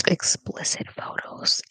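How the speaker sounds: a slow, secretive whisper; hushed whisper. Real, not acted. Embarrassed on stream, whispering the touchy words. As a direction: whisper it so family won't hear. Saying a taboo word on stream.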